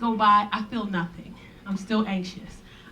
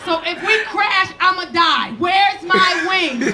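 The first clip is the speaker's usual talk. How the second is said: high, loud, laughing cry of panic; laughing shout; 6 semitones higher, 6 dB louder, slower than usual. Retold or imitated. On the plane, high on edibles, yelling at first class: loud laughing panic. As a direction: yell it like you're high and scared of crashing. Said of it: "started screaming".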